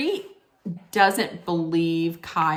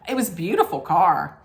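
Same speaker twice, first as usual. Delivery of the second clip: ordinary delivery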